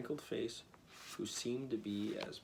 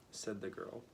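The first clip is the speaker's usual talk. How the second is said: ordinary delivery, nothing marked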